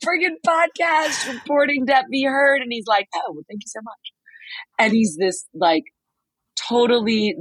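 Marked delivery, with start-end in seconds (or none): 0.0-2.6 very high, excited fan outburst; starstruck gushing. Retold or imitated. Retold fangirl outburst on meeting him. 3.1-4.1 soft, quick, modest British thanks; British accent. Retold or imitated. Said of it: "doing the British accent".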